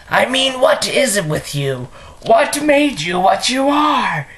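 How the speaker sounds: high, exasperated villain demand; theatrical villain questioning, swooping high; Mark Hamill Joker. Acted. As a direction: pitch leaps up as you demand an answer. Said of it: "my Mark Hamill impression".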